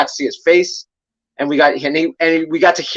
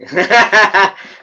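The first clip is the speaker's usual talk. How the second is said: laughing; 5 semitones higher, 3 dB louder than usual. Real, not acted. Laughs at own pronunciation.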